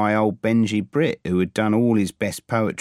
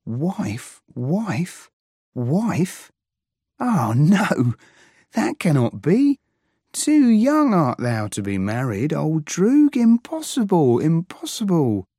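high, louder, rising disbelief; astonished outburst; 8 semitones higher, 4 dB louder than usual. Acted. Alex refusing to believe his old droog is married, high and loud. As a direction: build from repeated shock to protest. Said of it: "I, like, gaped".

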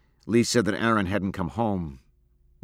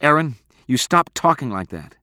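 sharp, louder, higher reproof; stern; 7 semitones higher, 8 dB louder than usual. A narrator's reading. Abra reproving Aaron for his talk, stern. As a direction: scold him firmly. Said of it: "Abra said sternly".

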